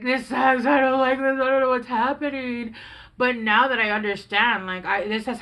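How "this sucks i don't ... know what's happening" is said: put-on old frustrated self. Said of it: "I would be like".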